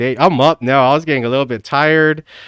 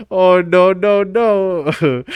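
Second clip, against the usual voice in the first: lightly imitated, higher mock dismay; playful echo of a meme; meme imitation; 5 semitones higher, much slower than usual. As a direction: climb higher on each no. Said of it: "I forgot all about that meme".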